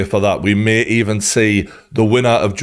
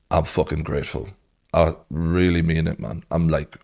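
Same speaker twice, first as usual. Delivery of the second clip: much softer, choked-up thanks; emotional gratitude; 6 semitones lower, 6 dB quieter than usual. Real, not acted. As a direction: let the feeling catch in your throat. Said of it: "really emotional".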